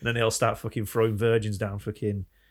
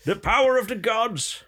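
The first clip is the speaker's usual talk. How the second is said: slow, high, louder, theatrical; grand prophet declaration; 10 semitones higher, 4 dB louder than usual. Retold or imitated. Rehearsed priest speech to the people, slow theatrical declaration. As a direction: sell it like a priest performing. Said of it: "You had to sell it".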